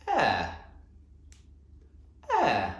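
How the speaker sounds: posh accent, falling away; playful posh English accent. Shown as a demonstration. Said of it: "say it in a posh voice".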